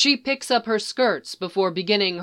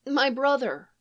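put-on shy little girl; 7 semitones higher than usual. Girl tells Leia about the baby, high and shy. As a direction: say it proudly but shyly.

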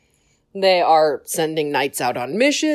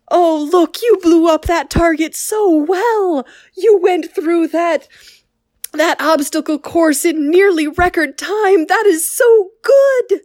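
higher, proud gushing praise; doting Plo; 9 semitones higher, 3 dB louder than usual. Retold or imitated. Plo praising the clones at target practice, gushing higher. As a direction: gush over them like a proud grandpa. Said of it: "constantly praising them".

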